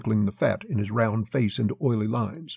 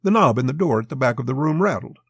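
ordinary delivery

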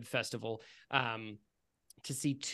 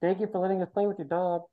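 put-on higher, quick, muffled little girl; Belly; 5 semitones higher, faster than usual. Younger sister thanks the dog's owner, muffled little-girl voice. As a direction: speak as a little kid from inside a pumpkin. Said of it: "kind of hard to hear her".

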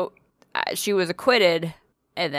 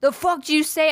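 dad's mock snap; 9 semitones higher than usual. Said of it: "he'd be like".

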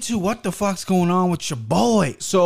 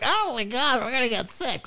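high, breathy panicky parent; mock-fret like a worried parent; 9 semitones higher, 5 dB quieter than usual. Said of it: "they're like".

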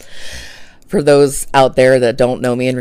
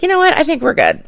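brushing it off; 13 semitones higher, faster than usual. Retold or imitated. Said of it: "I basically was like".

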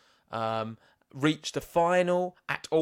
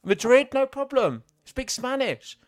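rising, louder disbelief, building with each city; piling up amazement, voice climbing higher; excited; 7 semitones higher, 5 dB louder than usual. Real, not acted. Host marvelling to co-host at Djokovic's languages, excited and high.

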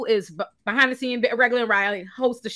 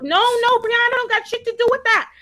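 raised pitch, loud and insistent; indignant rebuttal; 5 semitones higher, 3 dB louder than usual. Real, not acted. Host defends Brianna from blame, loudly indignant. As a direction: hammer it out, high and loud.

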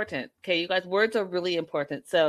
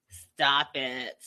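normal talking voice

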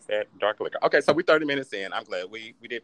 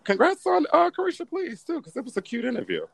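high mock congrats; 13 semitones higher than usual. Teasing congratulations, high pitched.

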